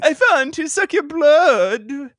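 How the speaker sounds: high, loud, slow hammy vampire; Dracula voice. Pretend. Host jokingly does Dracula for his cohost, loud and slow. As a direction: overplay a British Dracula. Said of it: "in that accent".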